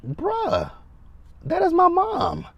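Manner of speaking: high-pitched, drawn-out protest to a cousin; retold indignant retort. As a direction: retell it as a pleading, high objection. Acted retort to cousin at funeral.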